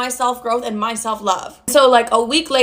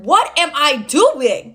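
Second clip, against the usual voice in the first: drawn-out, higher-pitched self-questioning; appalled realisation; 4 semitones higher, much slower than usual. Retold or imitated. Woman hearing herself at work, appalled and drawn out. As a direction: stretch it out in mock dismay. Realising her own foolishness aloud.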